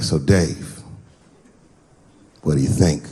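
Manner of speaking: low, probing question from a friend; imitated buddy, pitched well down; buddy's voice. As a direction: drop the pitch and ask pointedly. Voices buddy asking his opinion.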